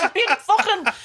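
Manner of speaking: high-pitched comic indignation through laughter; mock outrage. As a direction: rant incredulously, voice right up high. Mock outburst at fooled TV host.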